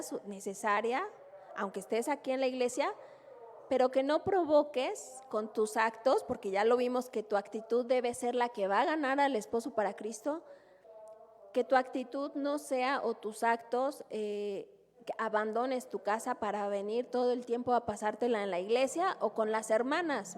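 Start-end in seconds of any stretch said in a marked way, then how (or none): none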